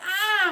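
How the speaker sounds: high, loud mock scream; short mock yell of pain; retold scream. Rider retells being crushed on fairground ride, mock scream. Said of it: "So I was screaming".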